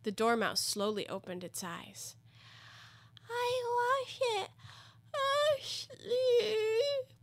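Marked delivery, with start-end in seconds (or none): 3.3-7.1 high, drawn-out feeble creature voice; hoarse, feeble. A narrator's reading. Woken Dormouse protests, in a hoarse, feeble voice. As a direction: drag it out weakly, half asleep. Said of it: "it said in a hoarse, feeble voice".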